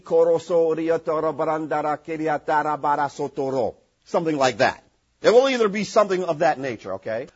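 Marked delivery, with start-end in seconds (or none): 0.0-3.8 rapid made-up syllables, mocking tongues; mock elaborate tongues. Shown as a demonstration. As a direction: rattle off invented syllables in a fake prayer language. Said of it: "or it will be more elaborate".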